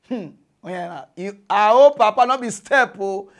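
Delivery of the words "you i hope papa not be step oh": heated outburst. Real, not acted.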